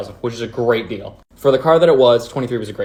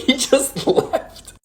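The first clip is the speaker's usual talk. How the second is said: high, slow, laughing in disbelief; 13 semitones higher, much slower than usual. Retold or imitated. Manager gone.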